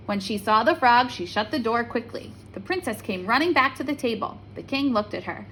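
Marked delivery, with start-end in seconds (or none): none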